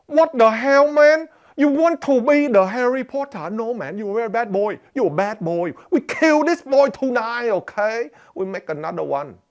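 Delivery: loud, high-pitched, heavily accented scolding; hammed-up outraged father with a thick accent; angry dad. Shown as a demonstration. Dad hearing his child wants magic, accented mock outrage. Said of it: "that's me immediately changing the way I'm using".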